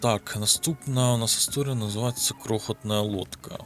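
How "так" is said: ordinary talk, nothing marked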